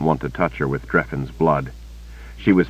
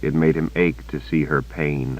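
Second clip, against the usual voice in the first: ordinary delivery, nothing marked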